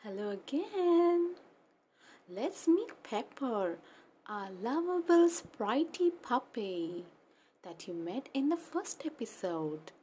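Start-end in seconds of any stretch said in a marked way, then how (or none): none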